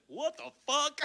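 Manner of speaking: high, incredulous outburst through laughter; mock disbelief. Promoter hearing the request for forty-three tickets, acted high-pitched disbelief. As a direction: imitate a stunned promoter. Retold promoter's shocked reaction.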